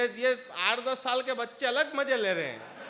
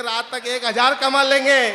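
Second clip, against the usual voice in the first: much louder triumphant call; cheeky shout; 11 dB louder than usual. Acted. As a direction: belt out the offer. Beggar boy's cheeky punchline.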